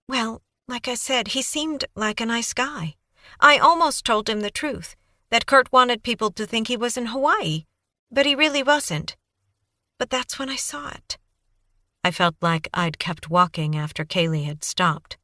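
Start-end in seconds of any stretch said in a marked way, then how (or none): 0.1-11.2 put-on high, breathless young woman babbling on; Kaylee's eager voice. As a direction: gush it out like a nervous, eager witness. Kaylee recounting.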